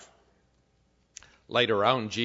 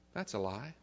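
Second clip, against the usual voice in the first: sudden drop to a soft aside; hushed rebuttal; 11 dB quieter than usual. Real, not acted. Preacher answering the tempting lie for congregation, hushed. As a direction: knock it down very quietly.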